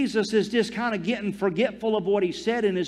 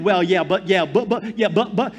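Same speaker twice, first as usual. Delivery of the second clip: mock nervous excuse. Mocking nervous givers' excuses.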